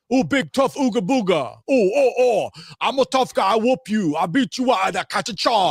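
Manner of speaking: high, loud grunting caveman threats; hammed-up brute making threats; Andrew Tate impression. Mocking Andrew Tate as a caveman, with high loud threats. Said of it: "It just keeps getting better".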